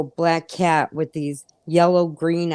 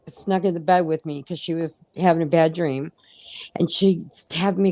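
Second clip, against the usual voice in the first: ordinary delivery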